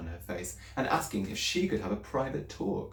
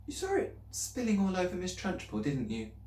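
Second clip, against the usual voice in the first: put-on higher, anxious little girl's voice; girl pleading with her teacher, higher and anxious; young Matilda; 10 semitones higher than usual. A narrator's reading. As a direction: speak up as a worried schoolgirl.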